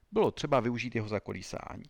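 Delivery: usual speaking voice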